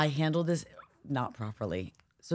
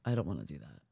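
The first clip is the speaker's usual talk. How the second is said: low quiet protest; 9 dB quieter, faster than usual. Real, not acted.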